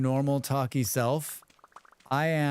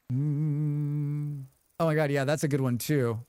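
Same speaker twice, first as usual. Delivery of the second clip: usual speaking voice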